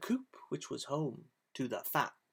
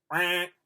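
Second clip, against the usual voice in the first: duck quack; 2 semitones higher, 7 dB louder than usual. Acted. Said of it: "said the duck".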